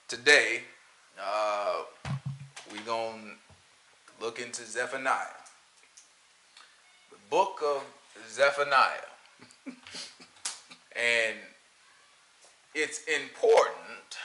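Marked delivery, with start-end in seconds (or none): 0.1-9.1 quietly hamming up a humble pastor opening the book; imitating a pastor. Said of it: "you know they don't talk like that".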